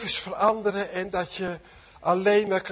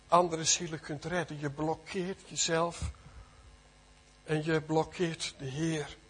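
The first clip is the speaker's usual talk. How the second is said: usual speaking voice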